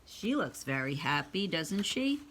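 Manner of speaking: ordinary delivery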